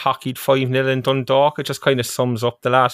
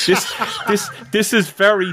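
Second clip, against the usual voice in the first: real laughter, higher; laughing; 7 semitones higher than usual. Real, not acted. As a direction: still laughing as you say it. Laughing at the ruined moment.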